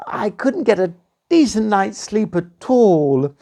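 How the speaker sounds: high, whiny princess; princess complaining. Acted. Princess telling the prince about the lump, high whiny.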